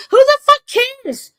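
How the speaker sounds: high, loud imitation of her exasperated rant; hammed-up furious squawk; mock pissed-off outburst. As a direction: yell it high like a fed-up woman, mocking her. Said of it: "She's pissed because it's like".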